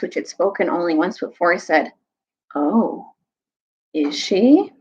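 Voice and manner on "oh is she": understated menace, broken by pauses; lightly voiced dreadful questioner; gray, terrible voice. A narrator's reading. Gray stranger questions the witch's death, darkly. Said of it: "That gray and terrible voice".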